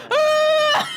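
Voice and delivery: loud high shriek of fright; startled scream. Real, not acted. Player screaming when Greg appears behind. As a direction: panic as something appears behind.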